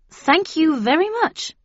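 high, loud polite thanks; polite reply. A narrator's reading. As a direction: brush them off politely. Narrator replies to witches.

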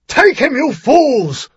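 slow, high, loud commanding yell; furious shout. A narrator's reading. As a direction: bark the order at his men. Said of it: "a voice shouted".

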